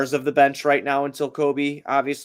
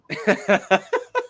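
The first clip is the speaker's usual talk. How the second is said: high burst of real laughter; helpless laughter; 9 semitones higher, 4 dB louder than usual. Real, not acted. Podcaster reading the chat loses it, helpless laughter. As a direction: crack up mid-podcast. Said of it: "You're making me laugh".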